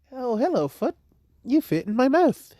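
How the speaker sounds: high, silly sing-song to her own foot; mock foot greeting. Kyoka puts her foot in mouth.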